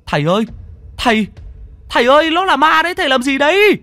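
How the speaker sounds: high, loud cries of alarm; frantic shouting. Acted. Villagers yelling at their master near a ghost, frantic shouting. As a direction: shriek urgently to warn the master. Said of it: "đám người đang kêu gào".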